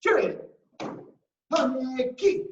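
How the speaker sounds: martial arts command shout. Shown as a demonstration. Leads martial arts drill calls.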